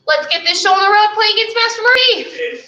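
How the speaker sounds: high, loud hype to kick off the game; rallying call. Real, not acted. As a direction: fire up the class, voice lifted high. Rallying the class to start.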